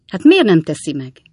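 loud, exasperated question; impatient. A narrator's reading. Sam pressing the silent cobbler, impatiently. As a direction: lean hard on it, out of patience. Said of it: "most már türelmetlenül".

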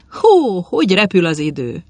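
high, loud burst of surprise; startled exclamation. Acted. Old Tubák realising he's late, startled exclamation. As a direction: jump as if the hour caught you out. Tubák suddenly notices the time.

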